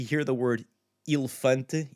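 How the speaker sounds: normal talking voice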